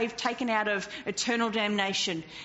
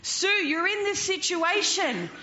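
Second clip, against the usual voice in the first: raised, accusing mock shout; Satan shouting; 6 semitones higher than usual. Preacher voicing Satan's accusing shout at Sue. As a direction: voice the accuser, pushing hard. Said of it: "Satan shouts at you".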